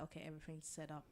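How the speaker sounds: very soft, quick imitation of a whisper; retold whisper. Tommy leaning forward to tip off Ghost, mock whisper. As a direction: pass it on under your breath. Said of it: "leans forward and whispers to Ghost".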